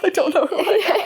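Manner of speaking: high, laughing self-parody; laughing mock wail. Reprising her confession for her friend, laughing mock wail. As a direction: send up your own crisis through laughter.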